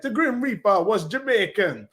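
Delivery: put-on amateur, playful Jamaican accent; poor Jamaican accent. Fan imitating the cartoon Grim Reaper, rough Jamaican accent. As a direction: try a Jamaican lilt, not very convincingly. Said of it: "Excuse my poor Jamaican accent".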